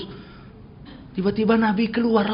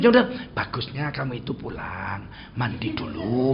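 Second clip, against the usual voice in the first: soft polite suggestion; 8 semitones lower, 5 dB quieter than usual. Pretend. Preacher mocking how a roundabout speaker would tell a man to bathe, soft and polite. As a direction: lower and softer, a gently hedged request. Mock overly gentle suggestion.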